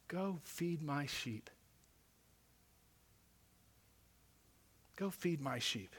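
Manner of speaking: Jesus quietly entrusting his flock; hushed, slow, tender charge; gentle command. Acted. Jesus answering Peter tenderly.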